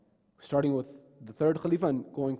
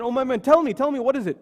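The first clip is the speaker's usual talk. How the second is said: urgent coaxing; 8 semitones higher, 7 dB louder, faster than usual. Acted. Prophet urges her to speak.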